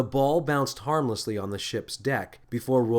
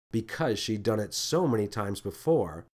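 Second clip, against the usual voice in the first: ordinary delivery, nothing marked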